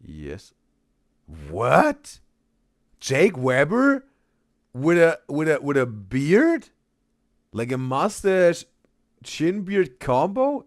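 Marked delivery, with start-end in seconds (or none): 1.5-10.6 loud, high-pitched disbelief; real double-take, slow and loud; astonished. Real, not acted. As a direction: stretch the words out in rising, incredulous questions. Astonished at old photo.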